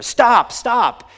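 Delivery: a sudden high, loud scream; screamed stop. Retold or imitated. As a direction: yell it out to halt the bus. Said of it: "stood up and screamed at the tour bus driver".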